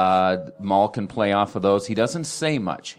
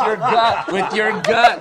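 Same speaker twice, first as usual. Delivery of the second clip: laughing high and loud; 10 semitones higher, 6 dB louder than usual. Real, not acted. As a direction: crack up at the joke. Joking to audience, laughing.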